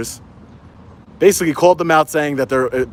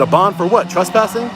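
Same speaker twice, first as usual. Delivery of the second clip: incredulous; 6 semitones higher than usual. Retold or imitated. Retells disputing the bond.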